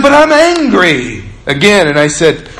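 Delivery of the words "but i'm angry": put-on high, slow whiny protest as the girl; mimicking angry teen girl. Pastor voicing the angry schoolgirl on his bus, high and whiny. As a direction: pitch it up like a sulky teen insisting. And she said.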